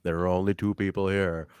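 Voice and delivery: low-pitched, measured wrestler impression; low-key promo. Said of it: "just being very low key in this one".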